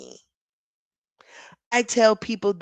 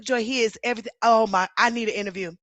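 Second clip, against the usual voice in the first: ordinary delivery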